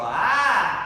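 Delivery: high, loud open ah. Shown as a demonstration. Coach showing a student a loud open ah. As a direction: let a loud free ah out. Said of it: "just go".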